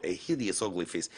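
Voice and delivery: put-on low, soft Russian accent; quoted in thick Russian English. Host quotes a news story, low Russian accent. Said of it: "That's the greatest Russian accent I've ever heard".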